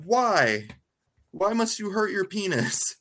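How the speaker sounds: high-pitched mock plea; pained dismay. Co-host reacting to the self-inflicted stunt, pained dismay. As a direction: plead in exaggerated dismay, pitch up.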